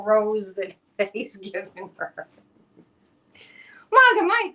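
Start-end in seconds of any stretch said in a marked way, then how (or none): 3.9-4.5 high loud announcing of the name; sudden loud call. Real, not acted. Host turning to the next story, loud call-out. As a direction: bark the nickname out. Calling out host's topic.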